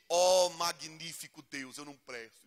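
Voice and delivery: ordinary talk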